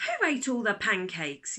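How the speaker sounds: loud demanding dad; yelled. A narrator's reading. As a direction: yell it across the table. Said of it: "yelled Dad".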